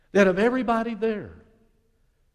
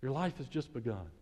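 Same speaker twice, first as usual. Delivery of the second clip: God's gentle voice; 3 semitones lower than usual. Pretend. God to the believer before the crowd, slow and tender. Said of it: "And He says for the whole crowd to hear".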